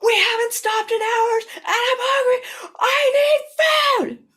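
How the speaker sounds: hungry employee yelling back at the boss, near tears; crying yell. Retold or imitated. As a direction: wail it like a starving, desperate passenger. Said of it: "but I yelled back".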